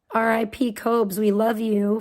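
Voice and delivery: normal talking voice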